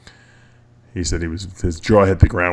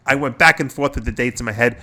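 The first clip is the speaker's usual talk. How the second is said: ordinary delivery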